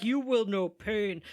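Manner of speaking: slow, lightly ominous villain voice; menacing alien overlord. Retold or imitated. Podcaster quoting the game villain, slow menacing imitation. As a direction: loom over them, slow and cold. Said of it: "he's like".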